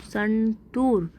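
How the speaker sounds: संतूर with the oo drawn out long; slow संतूर, second syllable stretched. Shown as a demonstration. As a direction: put extra push on a long oo in संतूर. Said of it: "ज़्यादा ज़ोर लगाना पड़ रहा है".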